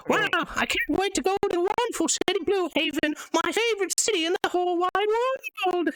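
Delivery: a naive sailor puppet cheerfully tempting fate. Acted. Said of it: "he says".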